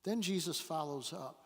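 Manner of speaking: ordinary talk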